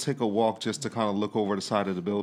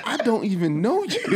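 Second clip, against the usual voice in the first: high, loud, laughing protest; alarmed protest; 9 semitones higher, 5 dB louder than usual. Retold or imitated. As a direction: act out panicked refusal for laughs. Retold alarmed protest to pursuer.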